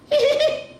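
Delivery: loud, very high tittering; escaping giggle. A narrator's reading. Bismarck losing the dare, escaping giggle. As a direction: burst into a quick squeaky titter.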